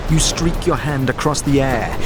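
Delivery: ordinary talk, nothing marked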